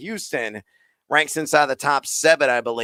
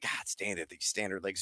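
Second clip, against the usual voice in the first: muttered under breath, much softer; exasperated mutter; 8 semitones lower, 16 dB quieter than usual. Real, not acted. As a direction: grumble it to yourself. Grumbling about standard leagues.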